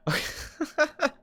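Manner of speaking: high chuckle in short bursts; real, wordless giggle; amused laugh. Real, not acted. Amused reaction to the chat.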